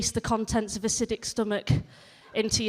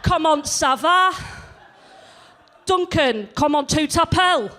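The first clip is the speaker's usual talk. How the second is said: put-on loud, slow French in a broad Yorkshire accent; louder, slow schoolroom French in thick northern accent; 5 semitones higher, 6 dB louder than usual. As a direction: swan in, French with a Leeds accent.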